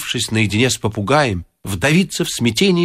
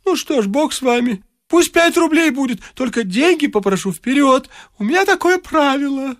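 put-on wheedling old man; 12 semitones higher than usual. A narrator's reading. Varfolomeich settles price.

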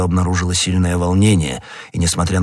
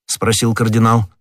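plain reading voice

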